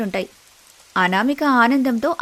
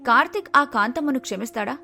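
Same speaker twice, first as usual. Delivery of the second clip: soft, hushed cliffhanger narration; dramatic episode recap; suspense teaser; 2 semitones higher, 5 dB quieter than usual. A narrator's reading. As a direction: leave the audience hanging.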